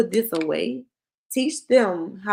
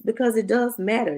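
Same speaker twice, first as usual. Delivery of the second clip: usual speaking voice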